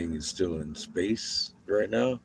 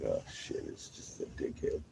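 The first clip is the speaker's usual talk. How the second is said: dog's inner voice; 13 semitones lower, 14 dB quieter than usual. Retold or imitated. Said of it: "He's like".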